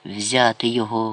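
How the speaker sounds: ordinary delivery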